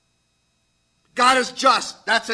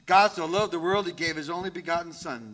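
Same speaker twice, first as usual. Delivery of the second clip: quick run through the verse, "Son" fading off; rushed reading; 3 semitones lower, 4 dB quieter, faster than usual. Shown as a demonstration. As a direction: rattle through it too fast, like skimming. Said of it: "We read that verse too fast sometimes".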